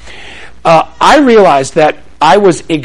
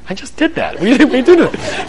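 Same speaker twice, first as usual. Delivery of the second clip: lightly voiced, higher sassy child; cheeky kid; 7 semitones higher than usual. Kid talking back to a parent, lightly voiced sass. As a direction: play the kid who just did it anyway. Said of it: "The kid's like".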